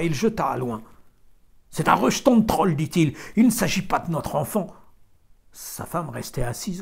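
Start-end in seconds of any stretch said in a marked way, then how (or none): 1.7-4.7 loud, higher angry declaration; harsh outburst. A narrator's reading. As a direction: throw the words out in disgust. Farmer spits and throws child.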